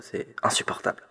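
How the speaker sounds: hushed, breathy fake dread; a playful whispered shudder; mock whisper. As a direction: drop to a whisper as if it's too awful to say. Mock dire warning ends in whisper.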